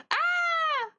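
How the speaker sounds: high pained cry; brain freeze cry. Real, not acted. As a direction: yelp as the cold hits. Sudden pain from cold strawberry.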